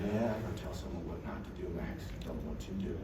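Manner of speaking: low, very soft aside; hushed coaching aside. Retold or imitated. Dad to son about rival's father, hushed coaching aside. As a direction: keep it between the two of you.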